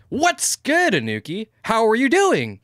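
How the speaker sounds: high, punchy influencer greeting; exaggerated YouTuber hello; mock YouTuber voice. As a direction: hail a friend like a vlogger. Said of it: "they speak to me like that".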